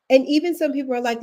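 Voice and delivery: normal talking voice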